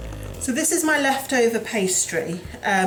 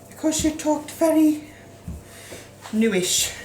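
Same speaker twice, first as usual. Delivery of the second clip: put-on Scottish accent; 6 semitones higher than usual. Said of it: "my Scottish nanny was Nanny New".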